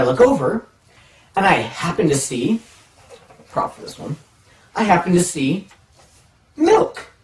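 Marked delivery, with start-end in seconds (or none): 3.5-4.2 quick whispered aside to the camera. Real, not acted. Quick aside about a prop. 6.6-7.1 much higher, puzzled surprise on one word; surprised discovery. Retold or imitated. Discovering the odd milk carton.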